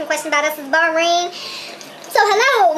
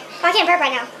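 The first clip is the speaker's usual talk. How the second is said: normal talking voice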